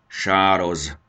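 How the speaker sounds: a grumpy, gruff reply; a mild growl from the dragon mother. Acted. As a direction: snap the greeting back suspiciously. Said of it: "mordult rá sárkánymama".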